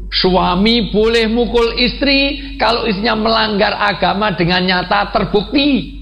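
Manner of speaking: high, slow preacher's proclamation; emphatic preaching. Real, not acted. (ceramah lantang bernada tinggi)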